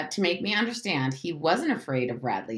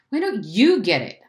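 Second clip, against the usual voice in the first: loud, raised comeback; higher, indignant shout; screamed retort; 5 semitones higher, 7 dB louder than usual. A narrator's reading. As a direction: throw it back at him loudly. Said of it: "I screamed".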